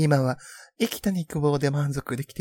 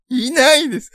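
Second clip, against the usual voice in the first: loud exclamation; 10 semitones higher, 9 dB louder than usual. Real, not acted. Denies such men exist.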